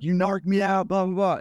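angry man accusing the narrator of narking, mild mock yell; retold yelling. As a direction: play the angry guy shouting across the laundromat. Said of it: "he is yelling at the top of his lungs".

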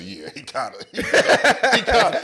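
mock Cam breaking into laughter; Cam, laughing. Retelling Cam's reply, a hammed-up laugh. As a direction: trail off into a laugh.

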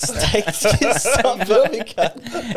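laughing through the words. Real, not acted. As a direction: laugh while protesting. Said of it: "that's wigging me out".

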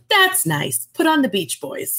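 offhand mother, lightly voiced; a light impression of a dismissive mother; imitating dying mom. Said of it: "And she goes".

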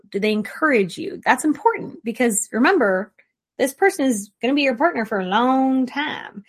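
normal talking voice

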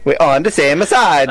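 hammed-up Italian accent; Italian accent with a laugh. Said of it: "do the Italian accent".

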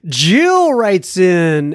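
normal talking voice

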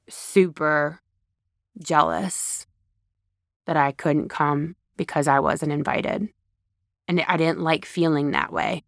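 sincere vulnerable confession. Shown as a demonstration. Speaker shows how to open up to a loved one, sincere and low. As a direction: confess your fears gently. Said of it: "you have real emotion".

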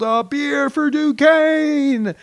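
long, high drawn-out shout; joyful slow shout from the air; yelled. Retold or imitated. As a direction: yell it across the sky as the chute opens. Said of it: "I yelled".

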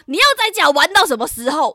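exasperated mother. Retold or imitated. Mother scolding her idle child at home, exasperated.

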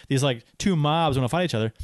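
ordinary talk

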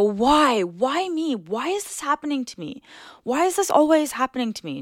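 high, despairing why me; self-pitying lament. Shown as a demonstration. Host acting out lost work for listeners, whiny lament. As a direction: wail it like you just lost hours of work. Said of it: "and just go".

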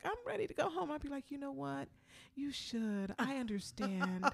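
soft, gentle reassurance; soothing. Retold or imitated. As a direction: coax them kindly and quietly. Soothing fellow contestants ready to leave.

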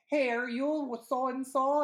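high, loud, slow challenge; imitated confrontational call-out; mock confrontation. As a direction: act out an angry woman confronting a rival. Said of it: "that woman confronts her like".

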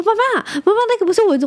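a small son begging his snoring mom to stop; little son's voice. Retold or imitated. Mom voices her young son.

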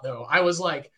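ordinary talk

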